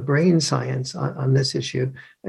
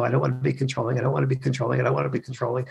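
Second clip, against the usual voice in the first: fading self-talk; 5 dB quieter, much faster than usual. Retold or imitated. Mother repeating to herself.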